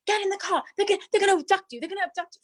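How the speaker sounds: high-pitched frantic warning, rushed; panicked friend. Retold or imitated. Scared friend begging her to get in the car, high panicked pleading. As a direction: squeal it like she's sure you'll be kidnapped. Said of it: "She was like".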